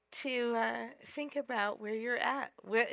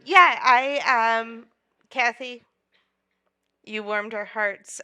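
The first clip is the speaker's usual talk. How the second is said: ordinary delivery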